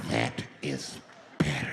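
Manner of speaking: very low, drawn-out approval; menacing calm. Retold or imitated. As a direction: drop it right down, word by word. Same man settles as crowd quiets.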